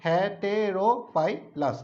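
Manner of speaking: হেটেরোপাইলাস said very slowly, one syllable at a time. Shown as a demonstration. Teacher splitting the name into syllables for students, very slow. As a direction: pull the word apart slowly. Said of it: "syllable আকারে ভাঙালে সুবিধা হয়".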